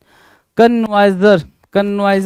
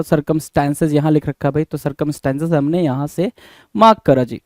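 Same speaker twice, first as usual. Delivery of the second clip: usual speaking voice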